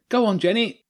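high-pitched supporter's call; high, eager sideline cheer. A narrator's reading. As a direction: call out encouragement to the team.

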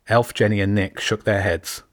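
plain reading voice

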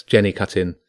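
ordinary delivery, nothing marked

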